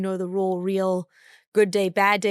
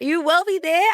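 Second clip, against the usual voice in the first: higher, louder confident declaration; declaring it; 9 semitones higher, 6 dB louder than usual. Real, not acted. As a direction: claim it out loud like a promise. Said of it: "I'm saying it here now".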